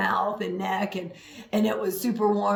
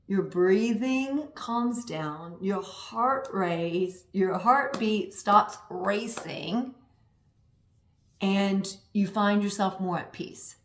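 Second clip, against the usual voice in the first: slow calming voice; 3 semitones lower, 5 dB quieter, slower than usual. Acted. Calming meditation-style talk.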